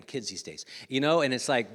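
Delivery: ordinary talk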